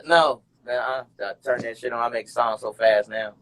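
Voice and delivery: ordinary talk, nothing marked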